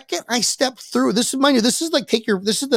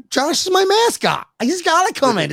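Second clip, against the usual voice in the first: much higher, half-joking plea; mock pleading; 9 semitones higher than usual. Begging the guard to let the goat in, mock pleading. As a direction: beg for the stuffed goat.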